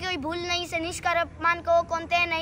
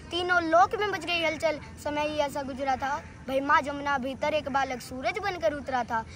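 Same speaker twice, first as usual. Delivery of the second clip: normal talking voice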